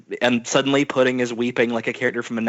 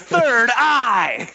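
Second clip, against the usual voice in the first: Pudding reveal; 12 semitones higher, 4 dB louder than usual. Acted. Pudding reveals her eye.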